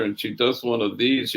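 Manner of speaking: ordinary talk, nothing marked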